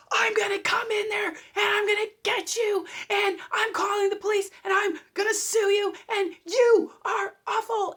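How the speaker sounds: high, loud screaming threats; hammed-up furious screaming threats; unhinged ranting. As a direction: rant like you've lost it completely. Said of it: "they're just going crazy".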